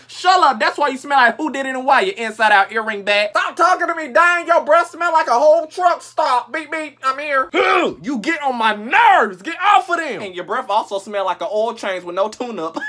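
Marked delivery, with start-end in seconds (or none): none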